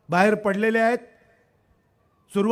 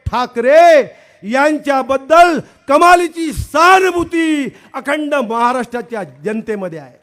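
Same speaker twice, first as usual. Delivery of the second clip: rally speech; 5 dB louder than usual. Acted. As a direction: rouse the crowd from the stage. Introducer's rally address.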